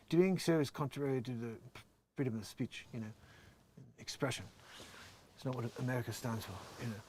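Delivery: lightly voiced Elon Musk, close to own voice; a hint of a halting tech mogul; Elon Musk impression. Reading Elon's TikTok post in a light Elon voice. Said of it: "that's my best Elon Musk impression".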